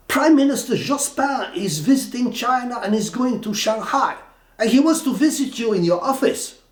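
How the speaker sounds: high, loud indignant boss on the phone; mock scolding boss voice; irate boss.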